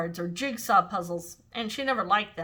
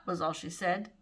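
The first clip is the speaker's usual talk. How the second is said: plain reading voice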